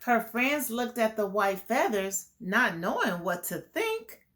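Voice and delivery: put-on reading a picture book to kids; lilting storybook read; story narration. A narrator's reading. Teacher reading the flamingo story to the class, lilting. Reads rhyming story aloud.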